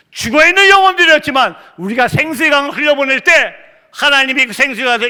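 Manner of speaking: high, loud preacher's proclamation; impassioned preaching. Real, not acted. Preacher proclaiming life to dead souls, loud impassioned shout. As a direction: preach at full force, pitch held high.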